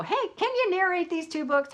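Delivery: high-pitched pretend phone call; pretend eager request. Acted phone call to narrator.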